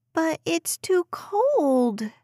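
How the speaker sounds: put-on high, slow whiny little girl; complaining child. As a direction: drag out the complaint like a kid. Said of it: "Des complained".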